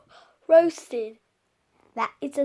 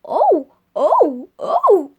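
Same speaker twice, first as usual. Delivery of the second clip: loud mock "oh, oh, oh"; loud hammed-up "oh oh oh"; imitating defensive dad; 5 semitones higher, 14 dB louder, much slower than usual. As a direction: blurt it like the flustered dad. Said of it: "He's like".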